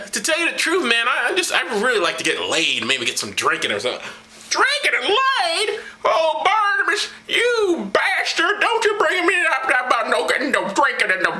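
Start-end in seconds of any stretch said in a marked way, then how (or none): 4.5-5.8 high, slow, scandalized apostle; prissy Paul voice. Acted. Paul recoils at Barnabas wanting drinks. 6.0-11.3 high, whiny scandalized preacher; high prissy Paul. Acted. Paul lectures Barnabas, shocked and whiny.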